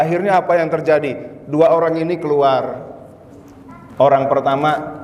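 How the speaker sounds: ordinary talk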